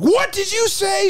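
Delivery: very high, slow mock outrage; imitated outraged retort. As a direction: mimic an affronted neighbour, squeaking up and stretching it. Retelling the offended neighbour's retort.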